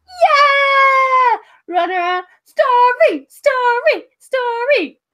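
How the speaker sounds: put-on very high, loud chanting child voices; cheering kids. Kids cheering the giant for a story, high and loud.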